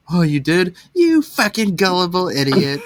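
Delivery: high, gleeful taunt; mocking glee. Prankster taunting a gullible victim about a fake accident, mocking. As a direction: jeer at someone who fell for it.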